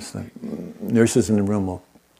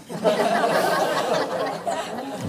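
high breathy laugh; delighted laughter; 14 semitones higher, 4 dB quieter than usual. Real, not acted. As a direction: laugh out at the punchline. Laugh after telling quote.

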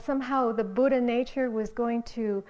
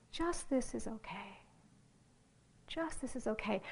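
softer, gentle inner voice saying it's okay; loving, compassionate voice; 8 dB quieter than usual. Retold or imitated. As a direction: say it like a kind presence accepting everything. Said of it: "loving, compassionate attention that is saying".

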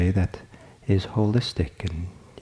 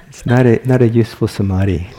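louder words pushed out through laughter; amused, laughing delivery; 9 dB louder than usual. Real, not acted. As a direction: talk while still laughing. Laughing through own remark.

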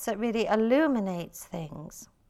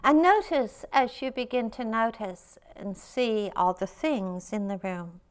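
guided meditation. Acted. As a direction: lead the room gently into stillness.

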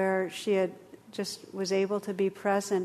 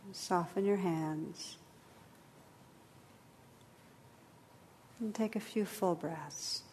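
guided meditation; 6 dB quieter than usual. Acted. Leads a meditation.

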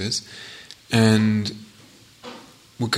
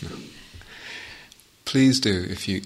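normal talking voice

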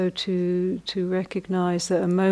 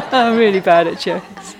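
laughing; 10 dB louder than usual. Real, not acted. Laughing at own joke-telling.

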